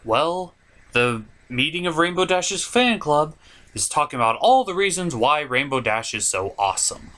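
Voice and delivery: ordinary talk, nothing marked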